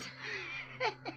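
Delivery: soft, high giggle; high little laugh under the breath; amused laugh. Acted. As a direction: giggle quietly at the driver. Gangster amused at the driver.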